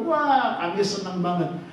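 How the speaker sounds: ordinary talk, nothing marked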